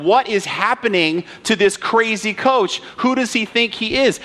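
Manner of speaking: high, indignant disbelief; outraged crowd. Retold or imitated. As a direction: imitate indignant fans questioning the coach. Outraged crowd voiced in a story.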